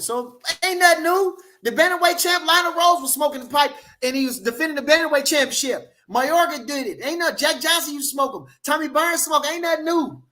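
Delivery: high-pitched, worked-up rant, pitch way up; excited rant. Real, not acted. Excited rant about fighters smoking.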